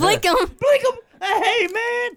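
high laughing shout; laughing hype call. Real, not acted. Host answering the girl's catchphrase, laughing high.

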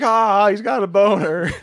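high-pitched jeering crowd imitation; hammed-up playground taunt; mock jeering. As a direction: point and taunt in a put-on high voice.

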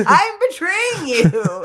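mock dramatic villain. Echoing the betrayal line mockingly.

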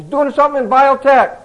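loud and high, sudden hunch; an eager salesman catching the scent; excited realization. Retold or imitated. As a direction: blurt out the thought as it clicks. Salesman's inner thought on a hunch.

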